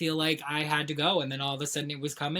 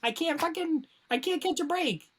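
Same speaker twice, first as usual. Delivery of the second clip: much higher self-pitying whine; mock self-pity whine; 12 semitones higher than usual. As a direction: whine about bad luck. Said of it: "I'm just like".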